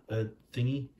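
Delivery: ordinary delivery, nothing marked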